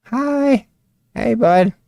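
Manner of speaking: high little greeting to a pet; cutesy pet talk. Real, not acted. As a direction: greet a tiny animal in a high voice.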